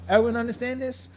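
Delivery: usual speaking voice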